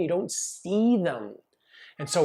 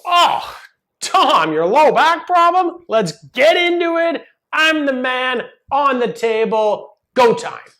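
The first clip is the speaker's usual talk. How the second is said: much higher and louder, hyped-up greeting; over the top; 10 semitones higher, 11 dB louder than usual. Retold or imitated. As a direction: blast it out like a pumped-up showman. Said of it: "You can imagine ... over the top".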